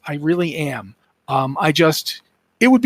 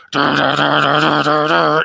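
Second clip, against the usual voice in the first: grinding riff noise; 2 semitones lower than usual. Retold or imitated. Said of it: "It's just constant".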